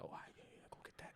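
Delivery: a sly, voiceless whisper to a buddy; teasing whisper. Retold or imitated. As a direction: whisper it like a knowing wink. Friends hint as he slides off.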